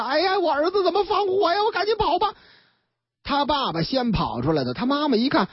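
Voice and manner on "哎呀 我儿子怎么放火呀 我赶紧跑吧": higher, mock panicked cry; hammed-up fright of a fleeing father; storyteller voicing a spooked old man; scared old man. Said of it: "把黄老头吓得".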